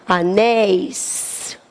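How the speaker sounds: "anéis" drawn out, soft and slow; slow demo word. Shown as a demonstration. Teacher giving the plural to pupils, slow and soft. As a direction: stretch "anéis" to show the plural ending. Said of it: "eu tenho".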